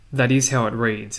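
ordinary talk, nothing marked

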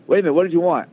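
lightly voiced tycoon calling him back; imitating a gruff boss. Steinbrenner changing his mind.

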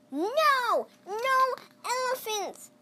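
high, loud, slow refusal; shouted. A narrator's reading. Grandma Tildy shouting no at the pet man. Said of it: "shouted Grandma".

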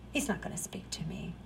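breathy whisper of self-doubt, fast. Retold or imitated. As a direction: whisper it quickly to yourself, defeated. Doubting believer's thoughts, whispered.

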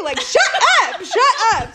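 high, loud, half-laughing protest; mock exasperated outburst. Woman fed up with his messages, mock exasperated outburst. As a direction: snap back in comic exasperation.

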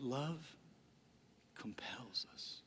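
compels us whispered, soft and slow. Shown as a demonstration. Pastor hushing the final line to his church. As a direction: breathe compels us as a hush. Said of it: "repeated phrase whispered".

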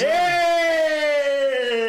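loud joyful whoop; excited shout. Real, not acted. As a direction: cheer out loud. Comemora a profecia. (grito animado)

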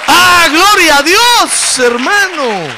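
very loud, high, drawn-out cry; full-force preacher's exclamation; exultant praise shout. Real, not acted. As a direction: shout praise across the whole church.

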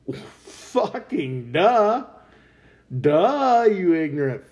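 drawn-out, very slow derisive duh, loud and high; mocking duh. Real, not acted. Mocking the European leaders' late discovery with a slow, loud duh. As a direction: stretch the duh like they're idiots.

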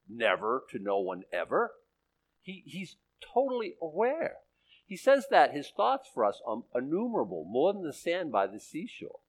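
usual speaking voice

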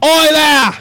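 hammed-up Australian call; put-on Aussie "oi"; Australian accent. Shown as a demonstration. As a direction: hail someone in a broad, put-on Australian accent. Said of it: "I can pull an Australian accent".

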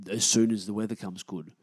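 usual speaking voice

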